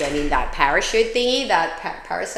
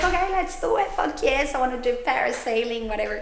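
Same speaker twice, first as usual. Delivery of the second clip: high, eager quoted self; a bright, high retold yes to the jump; 5 semitones higher, 4 dB quieter than usual. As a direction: sound thrilled to say yes. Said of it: "And it's like".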